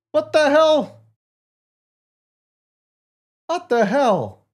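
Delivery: high, loud what the hell; sharp shocked yelp; incredulous shout. Real, not acted. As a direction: cry out in disbelief. Disbelief at the ban.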